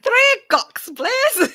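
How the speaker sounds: mock Irish accent. Friend repeating the Irish kid's order, mock Irish accent. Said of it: "repeating the Irish kid".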